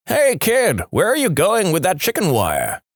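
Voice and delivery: put-on old man yelling. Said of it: "The old guy yells out".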